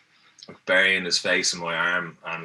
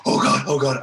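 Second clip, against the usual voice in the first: breathy mock panic of a scared flyer; panicked flyer, unvoiced; 4 semitones higher, 3 dB louder than usual. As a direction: gasp it out like a terrified flyer. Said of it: "he was like".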